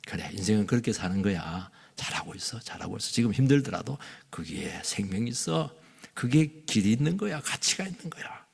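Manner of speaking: low, soft encouraging voice; acted tender support; gentle reassurance. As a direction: quietly affirm someone who is struggling. 성령의 지지하는 말을 연기.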